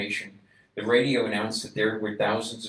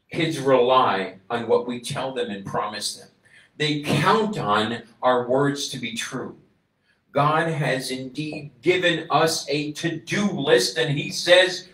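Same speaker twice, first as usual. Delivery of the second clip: louder, slow, rising sermon; preaching; 5 semitones higher, 7 dB louder than usual. Acted. Preacher exhorting the congregation, building to full force.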